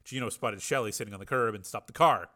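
ordinary delivery, nothing marked

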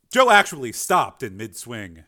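ordinary delivery, nothing marked

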